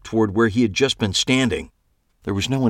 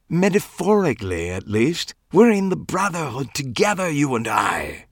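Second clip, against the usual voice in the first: peer out and ask fearfully, higher; fussy Clive voice; 6 semitones higher than usual. A narrator's reading.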